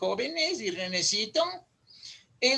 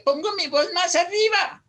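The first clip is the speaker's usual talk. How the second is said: raised voice; 5 semitones higher, 7 dB louder than usual. Shown as a demonstration. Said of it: "mi voz ahí es aguda".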